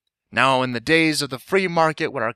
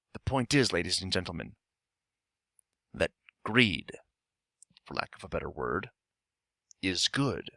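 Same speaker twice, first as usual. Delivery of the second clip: low, soft, with weighty pauses; hushed, deliberate oratory; 8 semitones lower, 10 dB quieter than usual. Acted. Shareholder makes his case for greed to stockholders, hushed. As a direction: drop the voice to make the room lean in. Orator lowers voice for key point.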